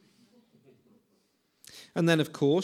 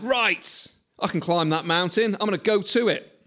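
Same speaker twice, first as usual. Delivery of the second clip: eager resolve; 3 semitones higher, 7 dB louder than usual. Retold or imitated. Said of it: "And I'm like".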